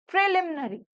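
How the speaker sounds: "प्रिलिमिनरी" with a short e and squeezed ending; correct pronunciation. Shown as a demonstration. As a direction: repeat "प्रिलिमिनरी" as a model, "pre-LIM-nuh-ree". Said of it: "आप उसको क्या pronounce करोगे".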